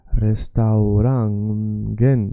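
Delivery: restaurangen stretched slowly, syllable by syllable; drawn-out syllables. Shown as a demonstration. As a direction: sound out restaurangen slowly for learners. Teacher sounds out new word.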